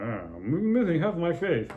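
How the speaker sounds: slow, strange put-on voice for a maimed deer; wounded deer voice. Joking about a maimed deer, slow odd voice. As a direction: drag it out like a half-faced deer moaning.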